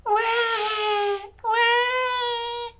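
put-on high wailing baby cry. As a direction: wail like a newborn. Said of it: "Moses began to cry".

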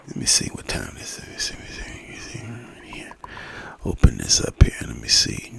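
low whispered muttering to himself; soft private whisper, mumbling. Real, not acted. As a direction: whisper under your breath while looking something up. Muttering to himself checking clock.

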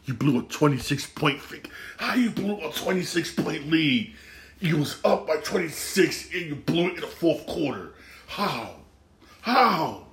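high, disbelieving rant at the team; venting frustration at a blown lead; exasperated rant. Real, not acted. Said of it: "sorry for ranting".

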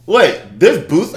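ordinary talk, nothing marked

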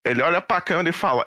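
usual speaking voice

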